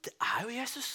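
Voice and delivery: hushed awed whisper. Acted. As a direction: breathe it in wonder. Awed realization in storm.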